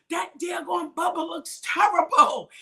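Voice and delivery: lightly voiced scoffing onlooker, drawn out; mock scornful onlookers. Retelling how people mocked the old mug, slow mock jeer. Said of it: "People would be like".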